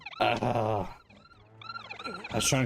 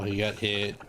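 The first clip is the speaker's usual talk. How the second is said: usual speaking voice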